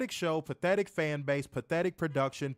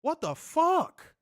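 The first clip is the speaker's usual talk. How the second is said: stunned outrage; 9 semitones higher, 3 dB louder, slower than usual. Real, not acted. Outraged at the broadcast.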